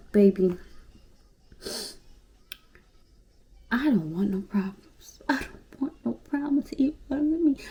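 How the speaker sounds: hushed, breathy plea to a friend; texting her friend that she wants no trouble, hushed. Retold or imitated. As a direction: whisper it like a peace offering. Retold text to homegirl.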